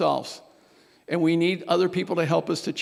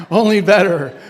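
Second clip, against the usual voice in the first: loud laugh breaking through the joke; 6 dB louder than usual. Real, not acted. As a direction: chuckle through the punchline.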